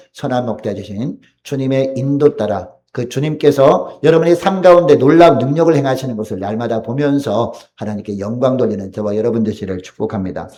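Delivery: usual speaking voice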